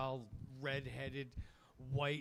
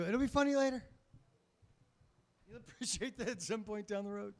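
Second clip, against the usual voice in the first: mock reassuring uncle; 7 semitones higher, 5 dB louder than usual. Uncle consoling nephew.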